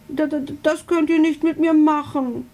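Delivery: high, stammering little dragon; stuttering. A narrator's reading. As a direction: stumble over the first word in dismay. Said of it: "stottert er".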